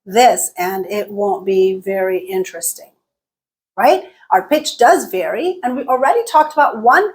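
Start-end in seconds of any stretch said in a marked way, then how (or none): 0.0-2.9 deliberately robotic, level-pitched delivery; monotone. Shown as a demonstration. Teacher showing how unvaried pitch sounds, in a monotone. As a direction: hold every syllable on one unvarying note. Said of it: "we would sound like this".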